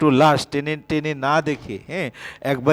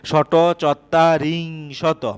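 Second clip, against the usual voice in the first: শত চত্বারিংশত spoken very slowly, syllable by syllable; slow dictation; much slower than usual. Shown as a demonstration. Teacher dictating the Sanskrit number to students, very slow and careful. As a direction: spell out শত চত্বারিংশত slowly for the class to write. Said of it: "এভাবে কিন্তু লিখতে হবে".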